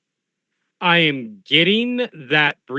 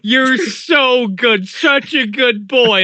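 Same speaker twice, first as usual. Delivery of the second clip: high, slow doting praise; cooing to a pet; 6 semitones higher, 4 dB louder than usual. Pretend. Player praising the gun like a pet, cooing. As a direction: coo at it like a good dog.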